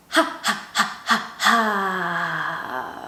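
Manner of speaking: short puffed ha's, then a long held ha; breath exercise ha. Shown as a demonstration. Teacher counts out a breathing drill, puffed ha's. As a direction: puff ha four times, hold and empty out. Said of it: "we're gonna say".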